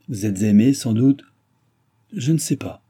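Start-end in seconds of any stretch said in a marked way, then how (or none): none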